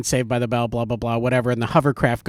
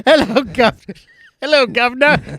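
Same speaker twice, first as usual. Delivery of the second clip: higher mock Cockney greeting; mock Cockney accent; 7 semitones higher than usual. Joining the joke about the British son, mock Cockney accent. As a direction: put on a silly Cockney accent.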